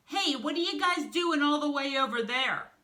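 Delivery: high, loud call across a distance. Acted. Tarantula calls across the car.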